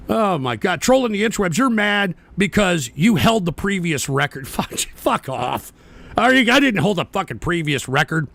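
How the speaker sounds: ordinary talk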